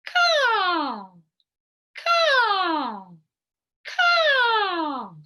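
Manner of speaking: loud, high crow caws rising; crow caw imitation. Shown as a demonstration. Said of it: "So it sounds like a crow".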